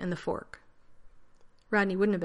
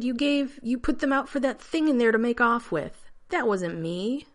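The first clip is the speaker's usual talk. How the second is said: loud; 6 semitones higher, 5 dB louder than usual. A narrator's reading. John shouting in argument.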